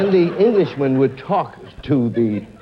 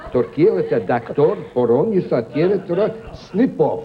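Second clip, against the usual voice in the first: mock-Russian accent, telling a story in gibberish; Russian double talk. Shown as a demonstration. Comic performing for the host, Russian double talk. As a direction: fake Russian with key words poking through. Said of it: "I'll do a Russian now".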